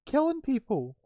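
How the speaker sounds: high, loud panicky guard voice; frightened guard. Acted. Frightened guard argues with the other about the cave, panicky. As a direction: protest in a high, drawn-out yell.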